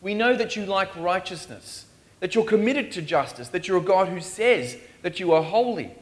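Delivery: normal talking voice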